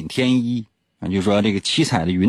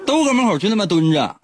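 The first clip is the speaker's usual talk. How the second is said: laughing; 8 semitones higher than usual. Real, not acted. Joking about the bathhouse.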